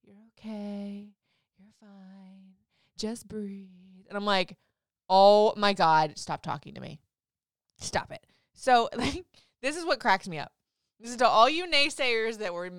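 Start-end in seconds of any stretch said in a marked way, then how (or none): 0.0-4.0 very soft, slow reassurance; hushed, drawn-out comforting; soothing nurse. Retold or imitated. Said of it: "she's, like, petting my arm". 5.1-8.2 loud, slow, fed-up outburst; exasperated patient cutting off the nurse; retold exasperation. Retold irritation at soothing nurse.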